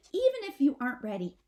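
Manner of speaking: quick, loud push from mother possum; mother possum rallying nervous babies; urgent Mama Possum. A narrator's reading. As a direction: rush the words to rally them.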